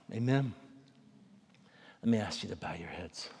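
usual speaking voice

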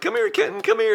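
high, sing-song coaxing call; parents coaxing toddler. Retold or imitated. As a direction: coax a toddler to come to you. Parents calling toddler to walk.

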